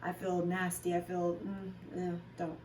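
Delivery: soft, recoiling mock plea; disgusted withdrawal. Retelling refusing her partner's touch, soft and disgusted. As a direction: shrink away quietly, feeling gross. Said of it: "I was like".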